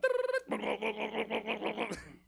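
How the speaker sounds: high trill into juddering sputter; imitated seizure noise. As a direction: mimic a switch setting off convulsions. Strobe light sets off acted seizure.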